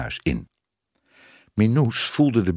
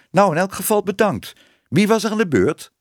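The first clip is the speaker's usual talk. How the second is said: higher voice of a shopkeeper; bakkersvrouw; 10 semitones higher, 4 dB louder than usual. A narrator's reading. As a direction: call briskly for the next customer. Bakkersvrouw bedankt en roept volgende.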